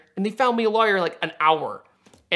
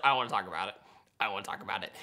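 lower, softer sulky refusal; grumpy mumble; 9 semitones lower, 10 dB quieter, much faster than usual. Retold or imitated. Grumpy driver to his fiancée in the silent car, sulking quietly. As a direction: shut the conversation down, sulking. Sulking after getting a ticket.

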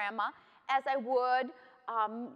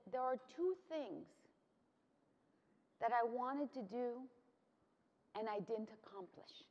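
soft, subdued voicing of grandma's regret; retold tearful regret; 8 dB quieter than usual. Grandma tells granddaughter her regrets, retold softly and sadly. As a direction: let it sink to a near whisper at the end. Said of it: "she was crying".

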